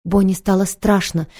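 ordinary delivery

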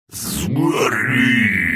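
high-pitched gurgling monster cry; monster shout. A narrator's reading. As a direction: shriek the curse like a lava beast. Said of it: "крикнул он".

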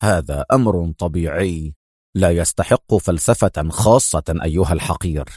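stern, low-pitched threat. A narrator's reading. Adham threatening his rival for information, stern. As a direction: warn him in a deep, severe voice. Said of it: "في صرامة وهو يقول".

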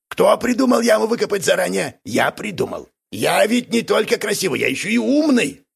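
lightly voiced boastful Гнусис. Гнусис praising his own pit, boastful.

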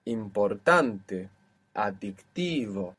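Importante on TAN, adictivo on TI, slowly; measured model words, Importante and adictivo, second-last syllable stressed; penultimate stress. Shown as a demonstration. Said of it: "still on the second last".

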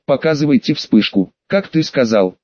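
ordinary delivery, nothing marked

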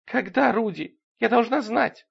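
high, pleading woman's voice; tearful demand. A narrator's reading. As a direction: press for an answer, acted distress. Sobbing fiancée presses for an answer.

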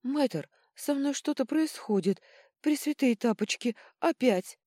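high, loud, alarmed cry; frightened princess. A narrator's reading. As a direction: call out in fright to the healer. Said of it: "раздался испуганный голос принцессы". (испуганный голос принцессы)

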